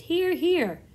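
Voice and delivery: a second creature joins the cheer; another creature cheering. A narrator's reading. Another creature echoes the cheer, high loud voice. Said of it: "they all shouted".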